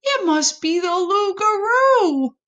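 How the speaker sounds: high frightened possum; scared. A narrator's reading. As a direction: squeak it out in fear. Possum terrified.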